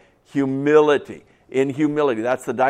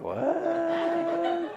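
confused listener; 8 dB quieter than usual. Retold or imitated. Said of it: "some of you are looking at me like".

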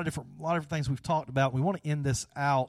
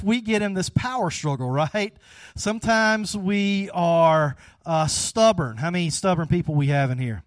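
ordinary delivery

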